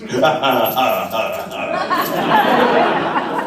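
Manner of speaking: evil cackle; villain laugh. Acted. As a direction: gloat with a laugh. Villain laughs after threat.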